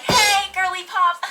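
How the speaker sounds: put-on high, slow, sing-song "girly pop" with a giggle; playful high drawled hello; cutesy greeting. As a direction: sweeten it up for the group chat. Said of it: "message the Discord being like".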